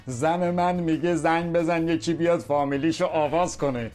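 ordinary delivery

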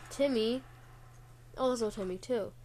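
normal talking voice

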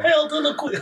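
high, laughing stab at an English accent; badly faked English, breaking into laughter; failed English accent. Shown as a demonstration. Trying the accent for friends, a laughing mock English. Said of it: "I can't even do it".

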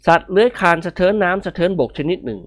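plain reading voice

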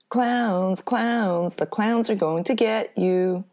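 put-on high, loud sing-song chant of children; taunting sing-song. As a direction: tease like a mean kid on the playground.

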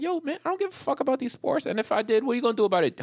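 high-pitched comic indignation; exasperated confrontation. Retold or imitated. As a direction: challenge a heckler in mock outrage. Acts out confronting heckler.

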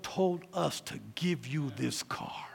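slow, whispered climax; awed whisper. Retold or imitated. Stranger tells the daughter about the car, slow hushed whisper. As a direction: let it land softly, in awe.

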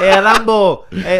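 high, very loud mock street-seller shout; loud hawker's call. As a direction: hail passing tourists like a hawker. Mimicking street sellers hailing tourists.

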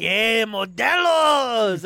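loud, very slow, stretched cheer; drawn-out call. Retold or imitated. Friends repeating their beer joke, loud drawn-out call. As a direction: call it out and drag the last word. Retold running joke, calling out.

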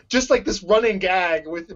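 normal talking voice

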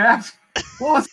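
usual speaking voice

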